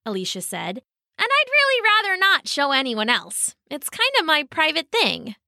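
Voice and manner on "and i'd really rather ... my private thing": high-pitched, politely reluctant tortoise; reluctant shy tortoise. Acted. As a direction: gently refuse in a high character voice. Tortoise declines.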